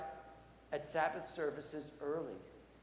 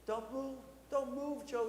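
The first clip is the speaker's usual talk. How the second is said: put-on higher-pitched, worried boy; urgent pleading; 7 semitones higher than usual. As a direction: plead anxiously with an injured friend. Boy urges injured werewolf to keep still.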